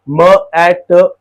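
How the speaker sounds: म ए ट, each sound said apart, slowly. Shown as a demonstration. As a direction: stretch out म ए ट sound by sound. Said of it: "categorise करेंगे जैसे ब". (ध्वनियाँ अलग करके धीरे बोलना)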